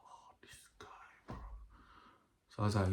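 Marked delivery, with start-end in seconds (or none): 0.0-1.4 barely audible whisper to himself; whispered aside. Retold or imitated. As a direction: whisper it as a retold inner thought. Retold private dread on seeing him.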